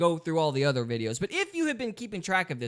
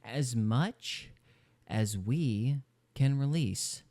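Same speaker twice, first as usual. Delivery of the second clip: slow, lower, pointed quote; drawn-out repetition; 4 semitones lower, 3 dB quieter, much slower than usual. Retold or imitated. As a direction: dwell on each word with long gaps. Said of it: "Let me say it again".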